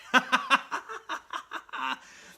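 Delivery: high breathless cackle, unhinged; maniac laugh. Retold or imitated. Villain cackling at Spider-Man, high maniac laugh. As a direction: cackle like a madman who's lost it. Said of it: "starts laughing like a maniac".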